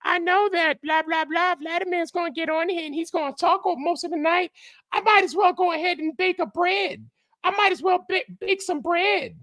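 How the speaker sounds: very high squeaky mock voice; Sixteen Alpha. Host relaying a chatter's joke about him, mocking falsetto. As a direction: read the joke in a silly falsetto, held on one high note. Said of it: "Alpha had a joke earlier saying".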